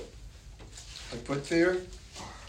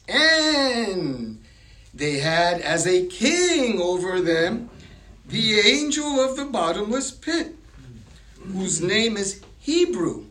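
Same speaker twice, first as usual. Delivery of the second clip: loud, raised, drawn-out Bible reading; emphatic scripture reading; 4 semitones higher, 4 dB louder, slower than usual. A narrator's reading. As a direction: proclaim the verse with weighty, stretched words. Reads scripture aloud emphatically.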